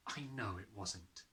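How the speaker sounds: put-on calm a frightened child; gentle reassurance. A narrator's reading. Teacher reassuring Matilda, soft and slow. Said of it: "Miss Honey said".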